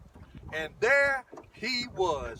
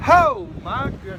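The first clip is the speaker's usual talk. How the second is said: amazed exclaim; 4 semitones higher, 10 dB louder than usual. Real, not acted. Amazed at big fish.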